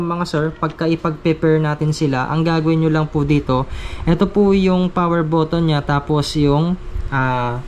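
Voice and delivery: ordinary talk, nothing marked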